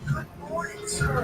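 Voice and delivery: high, sing-song morning hello to a dog; cheery dog greeting. Retold or imitated. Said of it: "I say to my dog".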